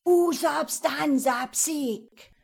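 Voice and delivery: slow, high, loud spell chant; incantation. A narrator's reading. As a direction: summon the evil by name. Conjuring spell words.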